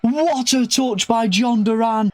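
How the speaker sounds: goal celebration. Real, not acted. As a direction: scream the winner in delight. Winning goal scored.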